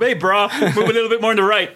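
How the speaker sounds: quick, high-pitched bro talk; Tim Pope gym bro. Retold or imitated. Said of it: "I imagine Tim Pope".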